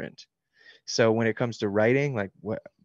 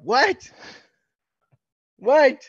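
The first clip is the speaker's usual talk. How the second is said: incredulous cry, jumping much higher and louder; mock disbelief; 11 semitones higher, 8 dB louder, much slower than usual. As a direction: build to a high, loud, baffled outburst. Mocking his own absurd teenage plot.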